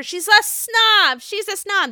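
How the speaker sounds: high, whiny-style mock accusation; hammed-up imitation, stretching out the accusation; Jennifer Aydin. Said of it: "And then, of course, Jennifer".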